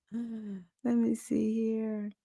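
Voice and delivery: whispered aside to herself; murmured under the breath while reading. Real, not acted. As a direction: breathe it out, slow and hushed.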